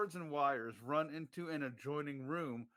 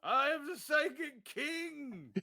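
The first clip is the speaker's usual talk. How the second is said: booming arrogant alien overlord; 9 semitones higher, slower than usual. Pretend. Declaring himself king.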